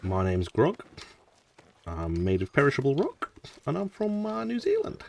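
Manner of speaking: put-on New Zealand accent. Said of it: "It's not the best accent".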